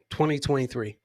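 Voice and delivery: usual speaking voice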